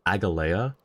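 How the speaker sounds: Agalea said ag-uh-LAY-uh; mispronunciation. Retold or imitated. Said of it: "someone had mispronounced it".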